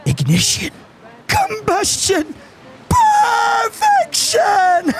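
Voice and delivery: slow, climbing to a high drawn-out shout; gloating maniac. Pretend. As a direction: savour each word like a mad bomber. Maniac gloats over explosion.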